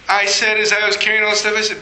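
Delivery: normal talking voice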